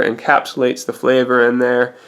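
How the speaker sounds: ordinary talk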